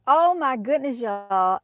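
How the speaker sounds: high, loud delighted exclamation; excited. Real, not acted. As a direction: burst out thrilled. Kids on little e-bikes.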